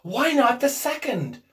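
high, loud, eager question; feverish. A narrator's reading. Old woman presses for a second wish, feverish. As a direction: push the argument feverishly. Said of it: "said the old woman feverishly".

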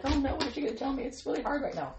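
mock panic about being scolded; mock worried whine. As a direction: rattle it off like a fretful listener. Mimicking a worried listener.

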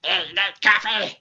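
imitated gremlin babble building to a shout. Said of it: "on the computer going".

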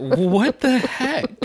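high, drawn-out astonishment; mock disbelief. Acting out a reaction to the neighbor, high mock disbelief. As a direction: stretch it out high, hamming up the reaction.

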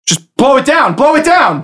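high, excited yelp; squawked, frantic urging; urgent in-character cry. Acted. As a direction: call it out high, egging them on. Answers in character, urging the caster.

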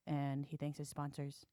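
low, soft, monotone mumble mimicking the rider; lightly mocking monotone rider; monotone imitation. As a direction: drone it flatly and quietly like a bored interviewee. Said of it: "he's very monotone".

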